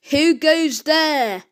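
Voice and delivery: slow, drawn-out challenge; demanding. A narrator's reading. Aunt challenges intruders in her castle, slow demand. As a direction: demand it imperiously, stretching the words. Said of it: "demanded a voice".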